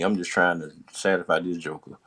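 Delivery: normal talking voice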